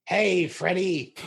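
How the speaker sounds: hammed-up, stretched character greeting; Dino. Shown as a demonstration. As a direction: draw it out in a put-on cartoon call. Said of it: "do your best Dino impression".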